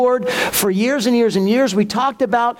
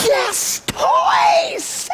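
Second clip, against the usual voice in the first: much higher, shrieked cry of joy; kids freaking out. Retold or imitated. Boys in the back seat cheering at the teller, shrieking joy. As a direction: squeal it like thrilled kids. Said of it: "the boys are just freaking out".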